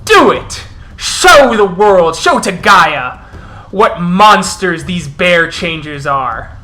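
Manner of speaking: loud, fierce rabble-rousing; impassioned war-leader, hard bursts and pauses; wolf elder denouncing. Acted. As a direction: shout the accusation to a whole crowd. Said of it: "as the Elder goes".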